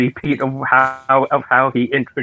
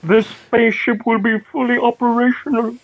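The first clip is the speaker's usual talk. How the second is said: high-pitched mock evil emperor; Emperor Palpatine; 10 semitones higher than usual. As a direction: gloat as a villainous emperor. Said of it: "familiar with the name Emperor Palpatine".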